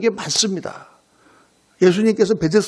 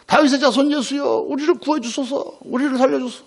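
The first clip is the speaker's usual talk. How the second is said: high-pitched pleading call, not full volume; mock shout for mercy, voice raised in pitch; imitated crying out; 5 semitones higher than usual. Blind beggars call to passing Jesus, a mild imitated cry. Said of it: "막 소리 지릅니다".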